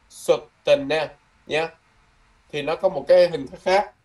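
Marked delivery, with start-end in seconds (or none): 0.1-1.1 Sotena said very slowly, each syllable drawn out; teacher's model word. Shown as a demonstration. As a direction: stretch out Sotena for the class to copy. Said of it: "Nó gọi là Sotena Mai".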